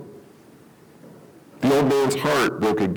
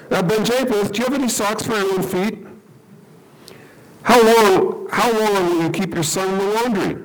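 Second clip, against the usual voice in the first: God, gently teasing; 8 semitones higher than usual. Acted. God teases the rabbi.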